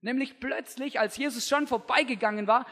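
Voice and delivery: ordinary talk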